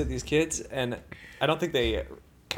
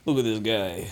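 drawn-out Italian American jeer; mock someone in Italian American; Italian American accent; 3 semitones lower, 7 dB louder, slower than usual. Performer jeering at deli request, Italian American accent. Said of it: "Italian American".

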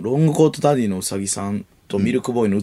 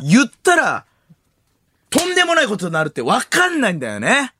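関明夫 impression; 10 semitones higher than usual. Warning about exposing a secret romance, theatrical impression. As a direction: build the reveal with big swooping pitch. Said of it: "いや、関明夫".

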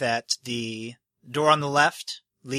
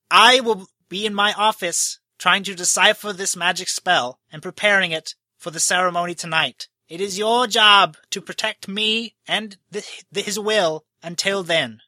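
higher, louder, hurried official voice; host giving orders; 5 semitones higher, 4 dB louder than usual. Acted. Host charging the three heroes to guard him, higher and louder. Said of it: "he points to Drain".

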